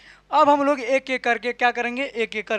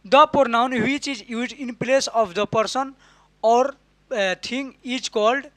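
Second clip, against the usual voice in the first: slow dictation; much slower than usual. Shown as a demonstration. Teacher dictates English definition.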